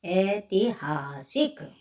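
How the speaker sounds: syllables stretched out slowly; slow read-aloud. Shown as a demonstration. Teacher reading ऐतिहासिक slowly for pupils to copy. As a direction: draw ऐतिहासिक out slowly, sound by sound. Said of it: "पढ़कर देखिए".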